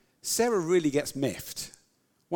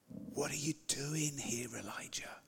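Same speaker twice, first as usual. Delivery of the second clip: gentle whisper; 12 dB quieter than usual. A narrator's reading. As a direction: whisper the question tenderly. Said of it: "the sound of a gentle whisper".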